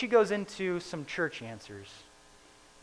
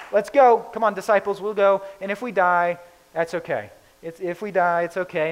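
loud, rallying call to the group; eager urging; 3 semitones higher, 8 dB louder than usual. Retold or imitated. Thomas rallying the disciples to go, loud urging. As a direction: rouse the disciples to follow, louder.

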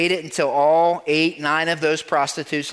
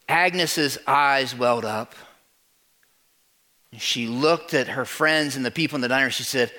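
ordinary delivery

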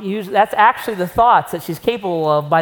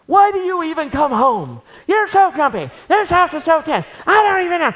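higher and louder whiny nagging, climbing as it goes; a shrill nagging wife who gets louder each line; 8 semitones higher, 4 dB louder than usual. Pretend. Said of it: "your normal reaction is what".

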